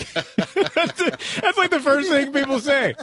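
high, laughing talk. Real, not acted. Host amused at what people say, laughing. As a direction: talk through real laughter, pitched right up. Laughs at nose remarks listeners make.

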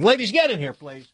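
real call to come in, dropping on please. Real, not acted. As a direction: call them into the room. Calling colleagues into the studio.

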